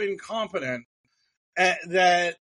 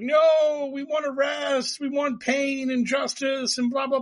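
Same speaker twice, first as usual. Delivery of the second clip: mock them as shrill and greedy for arrests; a hammed-up tantrum voice; mocking the conspiracy crowd; 8 semitones higher than usual. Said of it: "it's just like".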